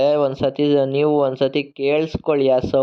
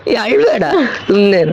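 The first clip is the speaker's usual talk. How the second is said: higher, louder coaxing; soothing; 4 semitones higher, 6 dB louder than usual. Real, not acted. Adult soothing a crying child, loud and coaxing.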